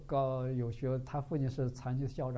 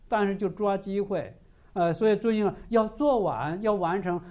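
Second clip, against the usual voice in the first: raised, higher, insistent sermon voice; fervent preaching; 4 semitones higher, 6 dB louder than usual. Acted. Preacher urging listeners to follow Jesus, fervent and raised. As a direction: drive the message home to the congregation.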